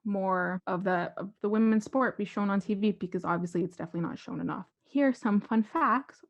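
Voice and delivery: ordinary talk, nothing marked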